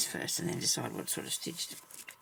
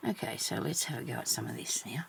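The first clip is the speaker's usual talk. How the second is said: whispering; 3 semitones lower, 5 dB quieter than usual. Real, not acted. Quiet crafting aside.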